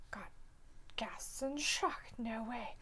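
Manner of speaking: whispered mock shock. Host reads a chat gasp in a whisper. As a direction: breathe it out in fake shock.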